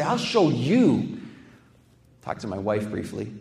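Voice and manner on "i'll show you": mock defiance. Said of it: "fire back and say".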